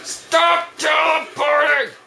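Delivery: high, drawn-out frustrated shout; exasperated yell. Real, not acted. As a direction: yell each word slowly at the opponent. Frustrated yell at game opponent.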